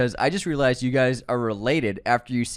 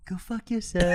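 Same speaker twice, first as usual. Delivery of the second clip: high laughing mock insult; imitating Les Grossman; 9 semitones higher, 4 dB quieter than usual. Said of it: "same as usual".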